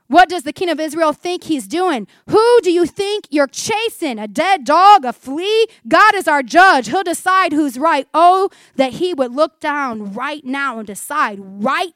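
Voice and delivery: David's indignant appeal to God as judge; impassioned challenge. Acted. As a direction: throw the questions out forcefully. Acts David challenging King Saul.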